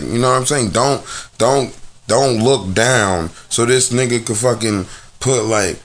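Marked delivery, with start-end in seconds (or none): none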